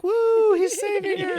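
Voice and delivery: high whooping audience cheer; mock cheering. Acting the audience as the heroine is saved, mock cheering. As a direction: whoop loudly like a thrilled crowd, hammed up. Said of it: "where you're supposed to be like".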